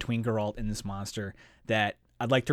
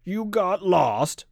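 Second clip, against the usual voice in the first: slow, higher, louder disbelieving echo; menacing repetition; 7 semitones higher, 5 dB louder, much slower than usual. A narrator's reading. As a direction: stretch the question out with mocking menace. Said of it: "repeated the monster, twisting his jaw in a menacing grin".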